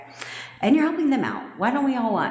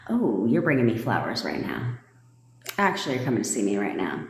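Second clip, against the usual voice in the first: casual affirmation; 9 semitones lower than usual. Acted. Acts out casual confident affirmations.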